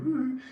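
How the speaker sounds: high loud whoop; a jokey lads' woo; mock cheer. Blokes cheering, high loud whoop.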